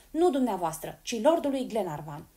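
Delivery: loud, stubborn correction; a determined boy standing his ground; firm insistence. Acted. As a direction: insist loudly. Boy insists on seeing lord.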